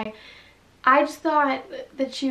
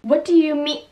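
angry; 4 semitones higher, 6 dB louder than usual. Acted. Character demands answers.

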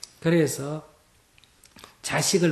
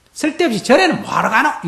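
higher, louder scoffing question; mock the wife's temple trips; dismissive husband scolding his wife, scoffing; husband's scorn; 8 semitones higher, 8 dB louder than usual. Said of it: "이런 생각이 든다".

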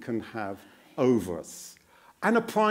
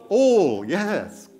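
normal talking voice

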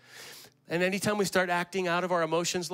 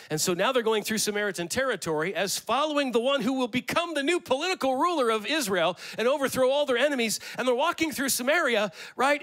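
high, loud sermon build; preaching at full force; 9 semitones higher, 4 dB louder than usual. Acted. Pastor to congregation, impassioned preaching. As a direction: drive the story up with rising fervor.

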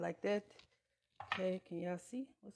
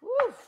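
high, loud whoop of relief; a sharp, high whoop; woo; 12 dB louder than usual. Real, not acted. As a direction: let out a big woo as the onions sting. Recovering from onion tears.

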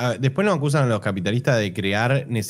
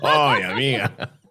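laughing sassy; slower than usual. Pretend. Repeated slow with laugh.

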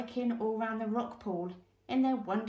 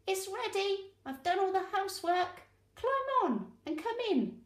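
cheerful bristleworm; 10 semitones higher than usual. Acted. Bristleworm welcomes friends.

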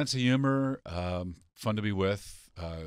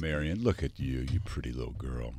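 sweet talk; 5 semitones lower than usual. Shown as a demonstration. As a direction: coo it gently, lower pitch. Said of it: "you wanted to tell her what a good girl she was".

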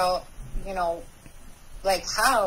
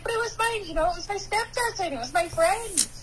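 high-pitched, pleading retold protest; 11 semitones higher than usual. Younger self defends trusting step cousin and friend, high pleading protest.

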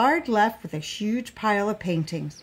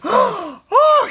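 loud, high startled cry; shout; 9 dB louder than usual. A narrator's reading. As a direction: cry out in shock. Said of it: "he shouted".

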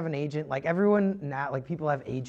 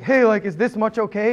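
eager question; 6 semitones higher, 9 dB louder than usual. Retold or imitated. Timidly asking the boss.

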